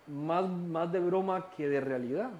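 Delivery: ordinary talk, nothing marked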